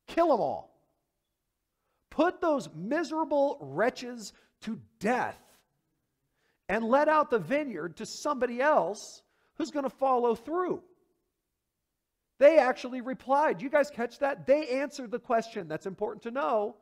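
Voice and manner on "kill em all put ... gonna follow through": higher, eager crowd verdict; voicing the crowd. Preacher acting the crowd's harsh answer, higher and eager. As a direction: blurt it out like the eager audience. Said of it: "What's the answer?".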